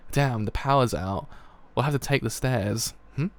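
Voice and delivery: ordinary delivery, nothing marked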